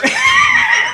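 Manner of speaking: high shrieking laugh; a sudden howl of laughter; loud burst of laughter. Real, not acted. Cracking up at the tail-wiping joke, loud shrieking laugh.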